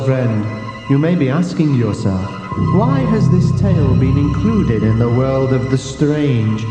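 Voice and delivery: ordinary delivery, nothing marked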